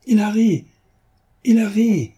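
delighted exclamation; joyful cry. A narrator's reading. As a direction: crow it out, jumping for joy. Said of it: "s'écria Lupin en sautant de joie".

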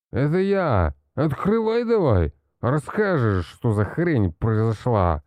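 put-on loud, slurred drunk shouting; town drunk. A narrator's reading. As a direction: bang on the door, roaring drunk. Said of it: "Ответил мне пьяный-припьяный голос Ильи". (пьяный крик)

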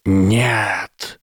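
sharp angry serpent hiss; furious hiss. Acted. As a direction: spit the word like an angry snake. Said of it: "яростно зашипела змея".

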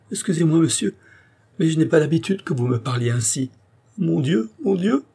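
high, overcome pleading voice; tearful distress. A narrator's reading. Andrée unused to such tender words, tearful distress. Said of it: "en fondant en larmes".

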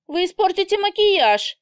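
high, loud outraged protest; shrill indignant cry. A narrator's reading. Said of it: "возмущается девушка". (возмущённо, громко)

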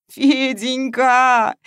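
slow, drawn-out wailing of the name; howling wail. A narrator's reading. Masha clutches the cat, wailing aloud. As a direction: wail it out at full voice. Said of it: "выла во весь голос".

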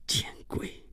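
whispered curse under the breath; inward curse. Acted. Gaoen secretly curses his clinging guest, whispered inner thought. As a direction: hiss it privately, almost no voice. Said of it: "高恩心里想着".

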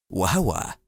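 usual speaking voice

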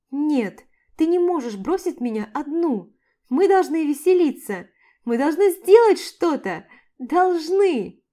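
loud, giddy, high pleading; drunk excited insisting. A narrator's reading. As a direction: insist like a tipsy girl. Drunk Mia insisting loudly.

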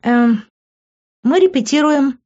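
put-on high, hesitant girl's voice; hasty excuse. A narrator's reading. Girl caught by her parents, hasty excuse.

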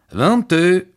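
loud, high croupier's call; Ausruf des Croupiers. A narrator's reading. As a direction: call the winning number out across the table. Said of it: "rief der Croupier". (laut und hoch ausgerufen)

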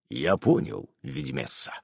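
low, soft reverent reply; bow to her with respect; old werewolf's low solemn answer. A narrator's reading. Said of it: "неожиданно и торжественно".

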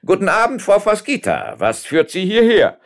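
loud, high-pitched greeting; called out. A narrator's reading. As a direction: call it out high and full-voiced. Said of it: "gähnte ununterbrochen und rief".

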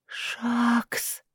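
soft, frightened shaky whisper; trembling whisper. Acted. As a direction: breathe the name in fear. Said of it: "прошептала она дрожащим голосом".

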